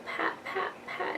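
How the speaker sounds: put-on breathy toddler whisper; whispered pat. Toddler imitating mom's gentle pat, whispered. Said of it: "she'll come over to me and go".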